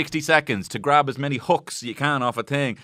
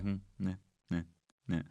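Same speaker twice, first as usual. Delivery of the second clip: much softer low bored hum; bored murmur; 6 semitones lower, 13 dB quieter, faster than usual. Retold or imitated. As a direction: feign polite boredom. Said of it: "Paul's just sitting there like".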